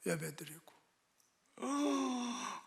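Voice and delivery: ordinary talk, nothing marked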